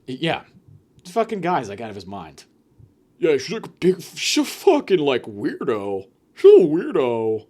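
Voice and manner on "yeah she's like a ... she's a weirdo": drawn-out "weirdo", mocking; mock guy insulting a girl. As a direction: play a guy trash-talking her. Acting out a guy insulting her.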